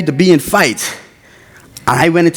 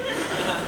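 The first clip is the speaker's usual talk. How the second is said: quiet laugh; 2 semitones higher, 16 dB quieter than usual. Real, not acted. Laughs after the outburst.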